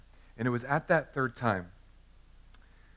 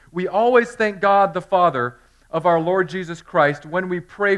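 louder, higher solemn scripture reading; 3 semitones higher, 8 dB louder than usual. Acted. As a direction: proclaim the letter to the church. Reads Colossians aloud.

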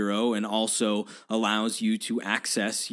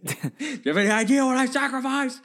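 high-pitched, hammed-up film quote; movie-line outburst; 13 semitones higher than usual. Podcaster quoting a favorite movie line, hammed-up outburst. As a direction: imitate an outraged film character, pitched way up.

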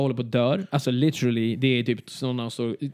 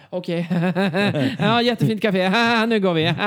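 strained politeness; 5 semitones higher, 4 dB louder than usual. Retold or imitated. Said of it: "typ så här".

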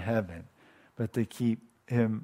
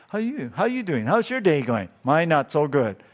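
casual Paul greeting; 4 semitones higher than usual. Pretend. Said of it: "Maybe he got up and said".

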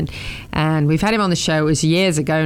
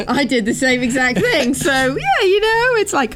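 giddy laugh; 10 semitones higher than usual. Real, not acted. Laughing excitement.

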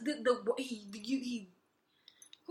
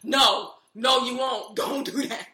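mock lawyers; 9 dB louder, much slower than usual. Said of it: "his lawyers in front of him like".

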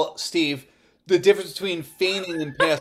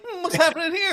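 nosy teacher; 9 semitones higher, faster than usual. Retold or imitated. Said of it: "walks in with an apple and is like".

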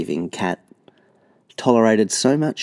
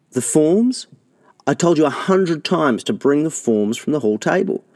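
higher, louder scolding mother; scolding mum; 8 semitones higher, 4 dB louder than usual. A narrator's reading. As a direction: nag about the forgotten forms.

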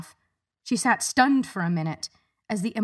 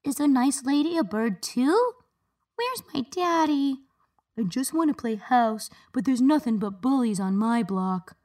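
put-on high, small children's voices; high childlike murmur, mildly plaintive; 5 semitones higher than usual. A narrator's reading. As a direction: murmur like lost little kids. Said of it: "The children murmured to themselves".

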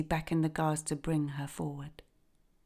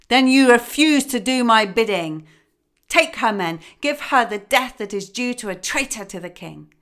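much louder and higher, raging sentence; furious king; 5 semitones higher, 10 dB louder than usual. A narrator's reading. Enraged king ordering his daughter's execution, furious shout. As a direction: roar the death order at the guards. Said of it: "He grew furious".

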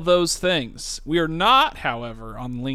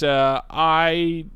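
ordinary delivery

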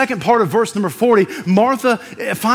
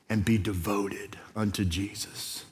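whispered; 10 semitones lower, 14 dB quieter than usual. Real, not acted. Pastor drops to a hush.

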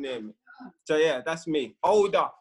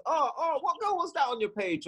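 higher-pitched mock jealous questioning; sly jealousy; 8 semitones higher than usual. Imitating women who ask jealous questions, slyly probing. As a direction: imitate a partner slyly fishing for answers. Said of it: "like, in a jealous fashion, or they try and slyly do them jealous ones".

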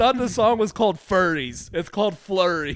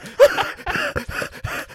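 wheezy laugh. Real, not acted. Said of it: "you're so wheezy".

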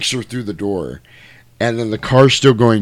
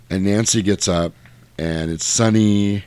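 normal talking voice

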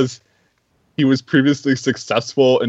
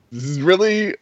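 higher, pleading, half-laughing; mock exasperation; 8 semitones higher than usual. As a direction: beg them to see sense, half laughing. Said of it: "and you're like".

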